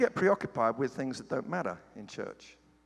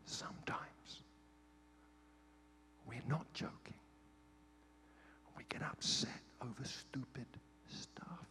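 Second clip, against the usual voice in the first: breathy whispered secret to the congregation; confiding whisper; 3 semitones lower, 16 dB quieter than usual. Shown as a demonstration. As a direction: whisper it like a confession. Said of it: "This is between me".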